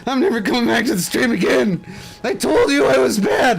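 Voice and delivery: high, fast whimpering mock sob of a hurt viewer; whiny crying viewer. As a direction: squeak it out like a crybaby quitting the stream. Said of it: "and he starts crying".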